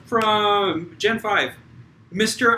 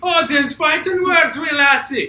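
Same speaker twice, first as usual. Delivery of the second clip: loud mock Scottish shout; Scottish accent; 6 semitones higher, 4 dB louder than usual. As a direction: shout it in a hammy Scottish brogue. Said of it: "they have a Scott off".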